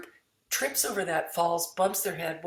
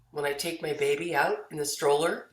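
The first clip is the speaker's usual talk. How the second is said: ordinary talk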